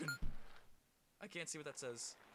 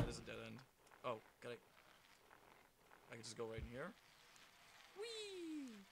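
ordinary talk

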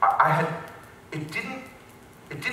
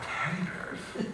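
incredulous; 3 semitones higher, 13 dB quieter, much slower than usual. Retold or imitated. He doubts what he heard.

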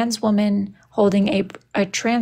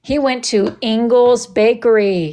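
ordinary talk

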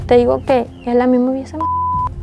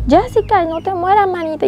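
higher-pitched retold plea of a crying friend; tearful pleading; 6 semitones higher than usual. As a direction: imitate a friend begging, voice lifted high. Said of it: "Y ellas lloraban".

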